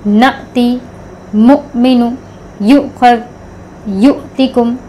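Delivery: each word clipped with a glottal catch at the hamza; jerky hamza. Shown as a demonstration. Teacher reading example words with the hamza jerk. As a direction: jolt the throat on each hamza, one word at a time. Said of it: "इसको झटके से पढ़ना है".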